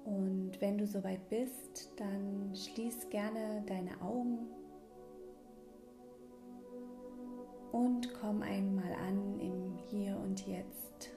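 calm breathing guide, gently paced; guided meditation. Acted. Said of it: "in diese kleine Mini-Meditation".

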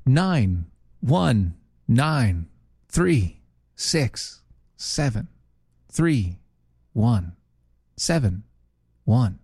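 slow digits with even gaps; phone menu voice. Shown as a demonstration. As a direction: read the number like an automated line. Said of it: "You didn't ask me to press one".

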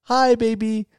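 put-on high, bright bye-bye to a baby; dad singing bye-bye to his baby girl; sing-song baby talk. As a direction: coo it to your daughter like a dad. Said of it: "and, like".

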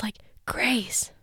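hushed whisper into the phone. Retold or imitated. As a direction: whisper it like a secret, imitating the caller. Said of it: "I just gotta whisper it".

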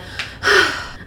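audible breathy sigh; acted-out sigh. Bella on the phone with mother, sighing. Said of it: "I sighed".

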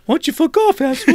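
high-pitched, teasing brush-off; mock nonchalant taunt. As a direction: imitate a carefree heckler in a high voice. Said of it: "All nonchalant and everything".

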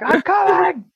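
high, loud hammed-up yell; high mock cry of coming; mock shout. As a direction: yell it out like a joke climax. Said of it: "I'm like".

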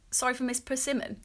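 ordinary talk, nothing marked

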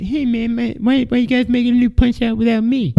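high, whiny hammed-up boxer voice; squeaky mock-hurt complaint; Mike Tyson impression. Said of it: "he's like".

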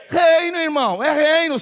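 loud, high proclamation of REINO; mais alto. Shown as a demonstration. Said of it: "Fala mais alto". (bem alto e agudo, puxando a igreja)

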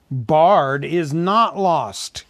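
ringing, drawn-out announcement; dramatic cry. A narrator's reading. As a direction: cry it out slowly for all to hear. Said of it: "he cried".

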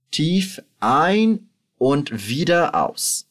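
slow, calm drill voice, each word drawn out; slow guided relaxation. Shown as a demonstration. Teacher guiding student through breathing, slow and calm. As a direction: lead the breathing slowly.

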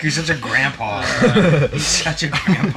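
high, loud laughing teasing; laughing tease. Real, not acted. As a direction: rib him while laughing. Teasing friend about Big Lots.

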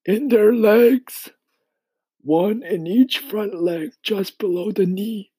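high, slow insect character voice; high, drawn-out storybook grasshopper; old grasshopper, explaining. Acted. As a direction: spell it out slowly in a high voice.

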